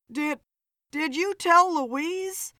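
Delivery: slow, stammered, slightly fearful; hint of fear. A narrator's reading. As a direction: ask haltingly, afraid of the answer. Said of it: "a hint of fear in Philip's voice".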